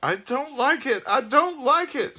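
high, loud panicky shriek; screamed. A narrator's reading. Frightened ghost fleeing back to the bus, screaming. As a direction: squeal it out in a piercing falsetto. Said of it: "screamed a voice".

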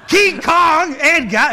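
high, loud hammed-up bellow; movie line shout. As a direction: belt it like a crazed cop. Acting out a famous movie shout.

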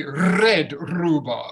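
slow articulation drill; slow clear red rhubarb. Shown as a demonstration. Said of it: "I want you to say".

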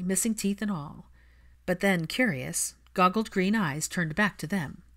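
ordinary delivery, nothing marked

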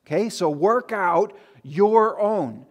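slow, and lean hard on own in "your own"; emphatic stress. Shown as a demonstration. Pastor to congregation, slow heavy stress on own. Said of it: "which is emphatic of saying this is ours".

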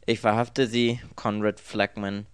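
loud, official announcement; official arrest. A narrator's reading. Detective arrests the man taken for Conrad, loud and official.

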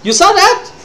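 startled high exclamation; surprised. Real, not acted. Sudden screen glitch mid-stream.

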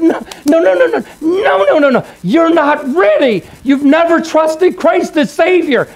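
high, forceful retold plea, no after no; desperate pleading. Friend's desperate plea to dying man.

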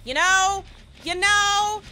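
high, loud, climbing with each repeat; rising helpless you know. Real, not acted.

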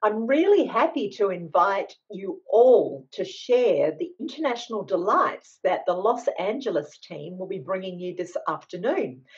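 ordinary talk

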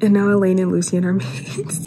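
ordinary talk, nothing marked

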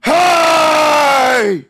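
long, high, held holler; distant shout. Acted. Game master as a stranger hailing the party, long shout. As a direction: holler it far across the distance. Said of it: "shout it like how he did".

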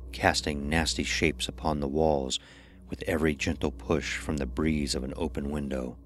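ordinary delivery, nothing marked

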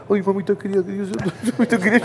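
high wailing mock sob, laughing through it; mock crying plea. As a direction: ham up a terrified teammate begging his mother. (imitando a un compañero que llora y grita)

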